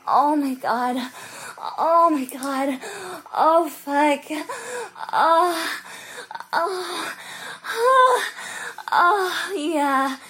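loud, drawn-out exclamations; overwhelmed moaning. Pretend. Speaker overwhelmed with partner, loud moaning cries. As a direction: cry out, overwhelmed. Intimate scene building to a peak.

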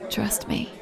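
soft, voiceless threat; menacing whisper. Acted. Warning the bully in a hushed threat.

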